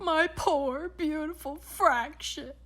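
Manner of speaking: a touch of theatrical grief; mild wail. A narrator's reading. Baron mourns his stolen fraction, mild wail. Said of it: "wailed Baron von Mathematic".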